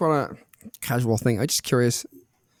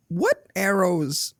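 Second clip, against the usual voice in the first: slow dramatic questions; much slower than usual. Acted. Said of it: "mock dramatic questions".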